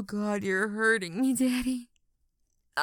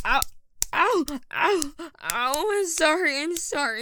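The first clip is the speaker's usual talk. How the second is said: pained cries; 5 semitones higher, 4 dB louder than usual. Acted. Being hurt, apologising.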